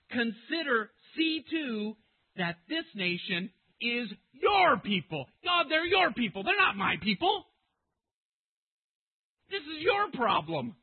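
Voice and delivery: high, loud shout stressing "your"; insistent protest. Acted. Preacher as Moses telling God whose people these are, shouting. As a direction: argue it at full voice, pushing back.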